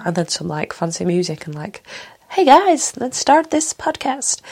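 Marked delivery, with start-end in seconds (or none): 2.3-4.4 much higher, slow, bouncy "hey guys" host voice; bubbly podcaster intro. Retold or imitated. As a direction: ham up a peppy YouTube host welcome. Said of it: "the whole, like".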